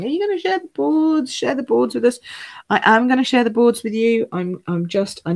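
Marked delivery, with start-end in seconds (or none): 0.0-2.2 high, pleading mock viewer voice; imitating viewers asking. Viewers asking the host about the show boards, high pleading mock voice. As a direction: lightly play the eager viewers begging. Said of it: "I know people have been saying".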